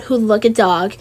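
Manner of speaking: plain reading voice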